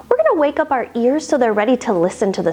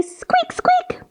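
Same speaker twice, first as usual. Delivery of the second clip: mouse squeaks; much slower than usual. Pretend. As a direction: squeak tiny like a teeny mouse. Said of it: "and say".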